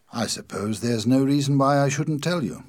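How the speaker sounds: plain reading voice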